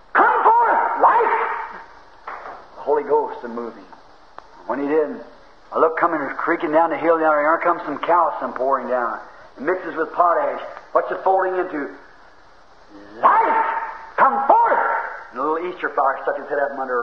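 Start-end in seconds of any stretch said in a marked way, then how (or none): 0.1-1.5 high, loud divine command; commanding shout. Acted. Voicing God commanding creation. 13.2-14.8 commanding shout. Acted. Voicing God commanding creation.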